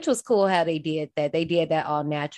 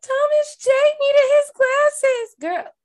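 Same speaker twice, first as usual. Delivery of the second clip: mock wail; 5 dB louder, slower than usual. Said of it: "I'm like".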